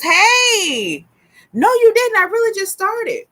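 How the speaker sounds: high, drawn-out hello; squeally mock protest to a friend; excited greeting. Real, not acted. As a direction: pitch it up high, delighted someone showed up. Greeting a viewer who arrives.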